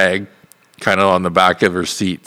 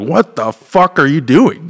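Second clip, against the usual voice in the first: slow, higher, alarmed mock yell; panicked yell; 6 semitones higher, slower than usual. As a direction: shout at the driver in panic. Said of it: "I'm like".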